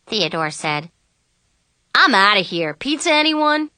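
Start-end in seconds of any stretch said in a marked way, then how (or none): 1.9-3.7 loud start, high-pitched offer; perky, louder and rising; breezy invitation. A narrator's reading. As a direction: toss out a lively invitation. Friend breezily suggests pizza.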